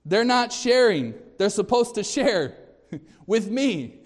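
imitating a child whining about sharing; whiny child. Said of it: "when one of them says".

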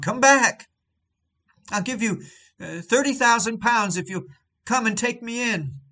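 high, loud shout across the water; desperate shout. Retold or imitated. Drowning gentleman shouting to the departing boat, high frantic yell. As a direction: call the boat back in sheer panic. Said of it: "who shouted to the boat".

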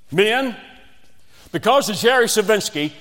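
high, loud, commanding coach call; coach's loud announcement. Retold or imitated. Coach announcing the team's punishment, loud and high. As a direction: bark the punishment out to the whole team.

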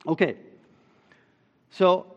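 ordinary talk, nothing marked

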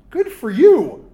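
loud, high mock congratulation; sarcastic praise. Real, not acted. Pastor mocking a spouse who expects perfection, loud sarcasm. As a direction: needle them with fake applause. Sarcastic jab at counseling client.

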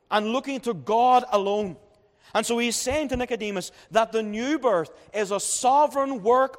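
fervent preaching. Acted. Preacher addresses Nicodemus fervently.

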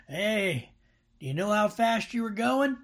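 lightly voiced officer pulling someone over; traffic officer. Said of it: "that officer comes up to you and said".

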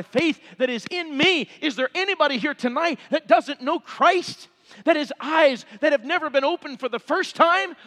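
urgent, high-pitched pulpit cadence; impassioned preacher calling sinners to come; fervent preaching. Real, not acted. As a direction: drive each phrase as a rising appeal. Preaches an altar call.

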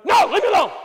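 high loud shout mimicking the sick wife; preacher voicing his sick wife snapping at him, loud shout; wife's sick outburst. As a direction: yell it as the miserable wife pushing him off. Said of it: "And I'd say something like".

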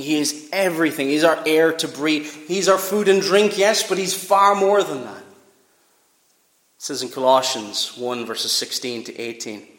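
ordinary talk, nothing marked

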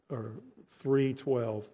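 normal talking voice

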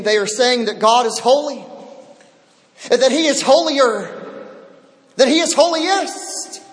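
stack holy, holier, holiest rising; building emphasis. Real, not acted. Preacher to the congregation, rising emphasis.

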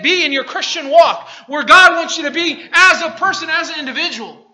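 loud, high, building sermon; fiery preaching. Acted. Preacher rallying his church, full-force preaching. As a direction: preach it hard to the congregation.

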